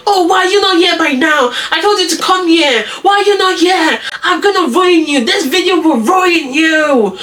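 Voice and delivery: high, exasperated mock tirade; acted angry outburst. Angry teacher's threats acted out.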